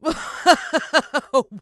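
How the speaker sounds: high, loud mocking villain laugh. Mister Motcher laughs at the boy's taunt, loud mocking laugh. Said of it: "looked at me and laughed".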